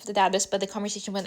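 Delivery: usual speaking voice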